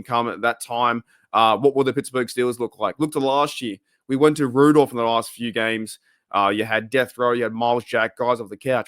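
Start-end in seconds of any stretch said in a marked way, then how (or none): none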